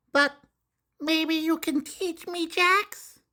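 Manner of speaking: put-on high, slow kid's voice; high girl's voice; little girl. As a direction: admit you don't know the game. Molly voiced, offering.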